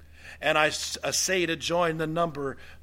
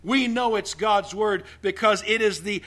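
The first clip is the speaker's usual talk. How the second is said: forceful preaching; 6 semitones higher, 7 dB louder than usual. Acted. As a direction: hammer each phrase to the congregation.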